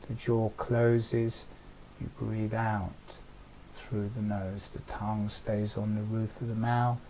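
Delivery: soft, unhurried breath-paced guidance; guided meditation. Acted. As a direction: let the voice sink with each exhale. Guides breathing with jaw movement.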